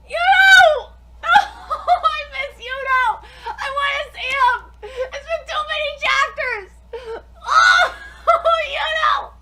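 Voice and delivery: loud, high crying out; tearful cry. Real, not acted. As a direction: wail his name through tears. Crying out for missed character.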